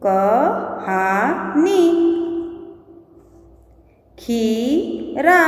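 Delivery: very slow, drawn-out syllables, long pauses between words. Shown as a demonstration. Teacher reading पाठ्य पुस्तक words to children, syllable by syllable. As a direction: sound out each word piece by piece.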